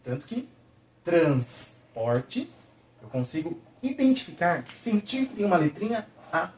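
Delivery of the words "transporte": drawn-out syllables. Shown as a demonstration. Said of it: "transmite o som dela pra toda a sílaba".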